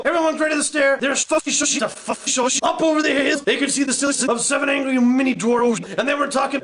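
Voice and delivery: normal talking voice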